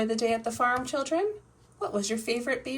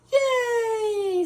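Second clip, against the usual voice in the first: high, loud happy cheer; children cheering; 11 semitones higher, 7 dB louder than usual. Pretend. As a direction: kids cheering about pizza. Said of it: "say George and Peppa".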